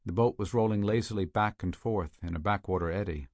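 ordinary delivery

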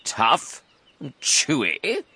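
slow, high, questioning echo; incredulous cry. A narrator's reading. Enormous croc echoing the smaller one, incredulous. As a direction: repeat it back in drawn-out disbelief. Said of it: "cried the enormous crocodile".

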